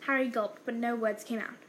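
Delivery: usual speaking voice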